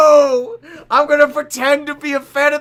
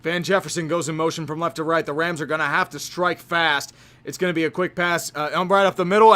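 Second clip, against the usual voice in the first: quick, lower announcer call; play-by-play; 8 semitones lower, 5 dB quieter than usual. Acted. Setting up the Rams drive for chat, quick play-by-play. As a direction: rattle off the formation fast. Streamer calls the game live.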